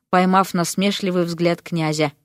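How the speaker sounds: usual speaking voice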